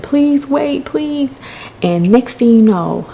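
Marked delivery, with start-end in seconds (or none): 0.0-1.3 high-pitched plea without full volume; mock pleading scream. Screaming after the departing car, mock desperate plea. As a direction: retell a desperate cry, high and thin. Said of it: "I'm screaming".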